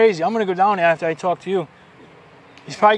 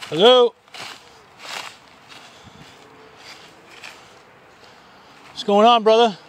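calling out; 6 semitones higher than usual. Real, not acted. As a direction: call out across the camp. Calling out to tent.

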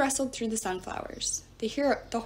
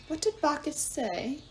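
slow, sneering mockery; mock their host's words; mocking goddess; 5 semitones higher than usual. A narrator's reading. Gaia mocks the heroes about Bacchus, sneering. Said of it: "the goddess mocked".

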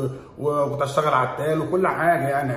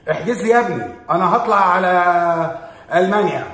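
mock loud declaration; 5 semitones higher, 8 dB louder than usual. Acting out fleeing abroad.

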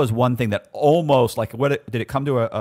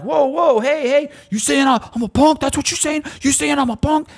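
high, louder imitation of the cellmate getting in his face; mock aggressive inmate; 4 dB louder than usual. Cellmate in his face in the bathroom, high mock aggression. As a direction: pitch it way up, needling and confrontational. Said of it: "And I'm like".

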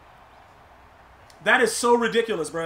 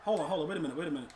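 muttered under breath; 8 semitones lower, 15 dB quieter, much faster than usual. Real, not acted. Muttering to self at game.

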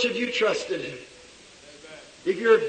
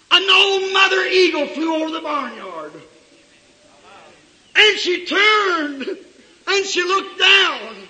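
high, loud, drawn-out preaching shout; full-force preaching; 9 semitones higher, 8 dB louder, slower than usual. Acted. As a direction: cry it out slowly to the congregation.

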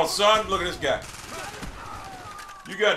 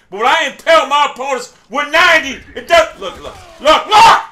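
indignant outburst; 6 dB louder than usual. Real, not acted. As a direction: escalate to an incredulous yell. Ranting about bullets in the game.

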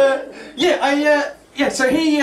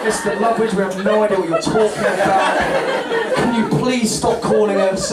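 weary call-centre voice. Retold or imitated.

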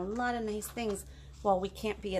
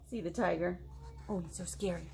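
plain reading voice

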